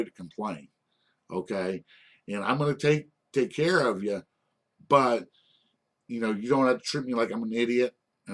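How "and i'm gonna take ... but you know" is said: ordinary delivery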